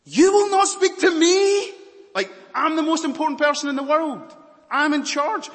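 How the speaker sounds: loud, high, furious shout; furious outburst. Acted. Pilate furiously shouting at silent Jesus, loud and high. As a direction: rage at him with wounded pride. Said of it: "He's, he's furious".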